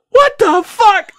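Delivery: slow, high, loud what the fuck; slow shocked high outcry; drawn-out disbelief. Retold or imitated. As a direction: stretch it out in shock. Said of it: "I'm like".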